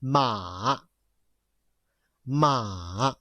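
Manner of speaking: low "Ma", going down and then up; long, dipping "Ma" with a rise at the end; third tone. Shown as a demonstration. Teacher models tone number three, low dipping "Ma". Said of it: "This is how we pronounce it".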